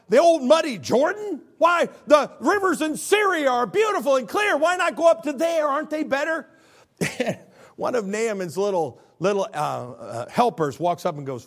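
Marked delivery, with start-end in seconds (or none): 0.1-6.5 mild mock indignation; hammed-up huffy general; Naaman indignant. Naaman scoffing at the Jordan, mild mock outrage. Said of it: "Naaman's like".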